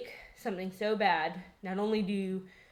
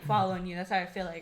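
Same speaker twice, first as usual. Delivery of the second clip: ordinary talk, nothing marked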